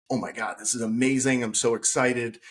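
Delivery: ordinary talk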